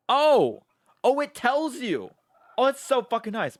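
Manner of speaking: loud, high, delighted outburst; excited discovery. Real, not acted. Streamer finds that the game explains terms, loud and excited. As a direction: exclaim with pitch way up.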